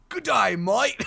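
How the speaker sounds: high-pitched, badly faked London accent; a hammed-up stab at London, "mate" as "moit"; joke London accent. Shown as a demonstration. Showing off accents to friends, jokey London accent. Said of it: "you wanna hear my London?".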